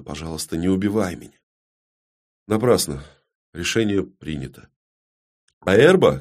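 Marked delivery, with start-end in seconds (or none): 0.0-1.4 very soft, hushed begging; whispered plea. Acted. Далина begs not to be killed, whispering. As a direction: beg under your breath. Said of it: "прошептала Далина".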